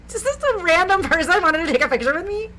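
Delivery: loud, high indignant outburst; mock outrage at being asked. Pop star asked to pose with a stranger, indignant. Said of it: "I feel like [name] being like".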